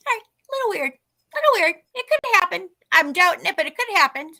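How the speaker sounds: high-pitched reasoning with oneself; acted high-voiced attempt at self-reassurance; doubtful self-talk. Reasoning with herself after stranger vanishes.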